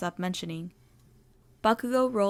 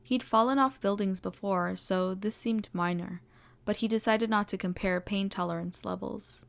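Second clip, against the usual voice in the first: ordinary delivery, nothing marked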